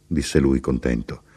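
ordinary delivery

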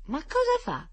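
high-pitched puzzled question; impatient. A narrator's reading. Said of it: "i bambini impazienti".

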